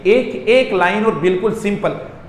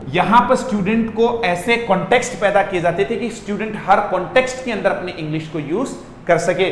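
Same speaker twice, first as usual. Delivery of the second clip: usual speaking voice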